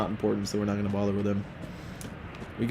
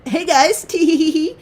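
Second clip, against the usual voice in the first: put-on very high, loud girly squeal with tittering; mock a giggly girl in falsetto; giggly gamer girl; 6 dB louder than usual. Girl opens on the team voice chat, high giggly mock voice. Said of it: "it's a girl".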